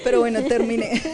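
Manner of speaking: ordinary talk, nothing marked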